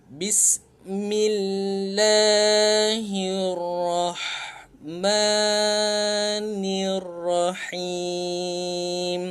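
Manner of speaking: very slow melodic chanting of "Bismillahirrahmanirrahim"; full recitation. Shown as a demonstration. Teacher recites the whole verse slowly for the class. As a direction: chant it slowly, each syllable held. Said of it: "Jom kita ulang sekali lagi".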